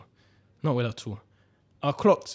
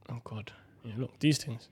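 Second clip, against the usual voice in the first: hushed aside about the trainers; whispering; 3 semitones lower, 10 dB quieter than usual. Real, not acted. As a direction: drop to a breathy whisper. Pointing out ugly trainers.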